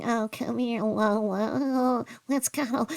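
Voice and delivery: high, gushy pet-cuddling voice; imitation of sugary dog talk; mock cooing. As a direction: coo mockingly, then giggle. Imitates her cooing at favourite dog.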